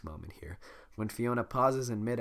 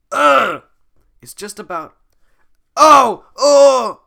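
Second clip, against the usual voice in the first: pained yelps; 12 semitones higher, 20 dB louder, much slower than usual. Acted. Shrek yelping as pulled.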